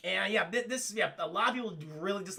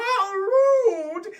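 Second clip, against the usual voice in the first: loud, high, hammed-up wail; mock indignation; 10 semitones higher, 11 dB louder than usual. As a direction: play an affronted lady, overblown. Retold offended woman's outcry.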